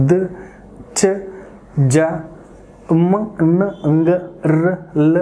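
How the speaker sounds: short isolated phonics sounds, "ప, బ, ట" as puh, buh, tuh; "మ, న, ల" as bare letter sounds, not letter names; demonstrating consonant sounds. Shown as a demonstration. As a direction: sound out "క, గ, ష" one at a time with pauses. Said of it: "వీటిని sounds కాబట్టి వీటిని మనం ఇలాగే".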